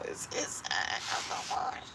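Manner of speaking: soft, breathy frightened whisper; whispering in alarm that the spider is inside; scared whisper. Real, not acted. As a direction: hush it, afraid to disturb it.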